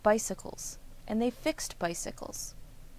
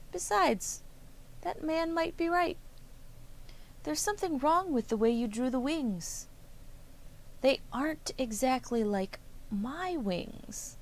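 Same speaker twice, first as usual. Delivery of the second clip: high, bright bird voice, pitched well up; a tiny bird gently correcting, high and light; chirpy little bird; 6 semitones higher than usual. Acted. Bird critiques the wing plans.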